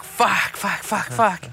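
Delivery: a burst of panicked swearing as the roll escapes; frustrated swearing. Retold or imitated.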